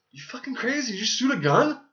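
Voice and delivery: high, panicked yelling; freaked-out passenger. Retold or imitated. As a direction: shriek like a scared passenger. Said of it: "he's like the passenger".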